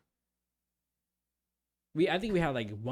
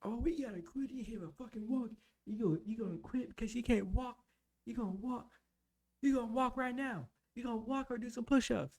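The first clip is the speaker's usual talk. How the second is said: high-pitched mock drill-instructor hollering; imitated instructors yelling; 11 semitones higher than usual. Several instructors ganging up on a recruit, high mock yelling. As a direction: taunt him in a raised, strained holler.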